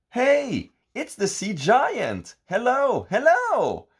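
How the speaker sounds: high, loud happy shout; shouting hello. A narrator's reading. Goody and Gooda greeting the sea giant with a loud high shout. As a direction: shout and wave back. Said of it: "Goody and Gooda shout".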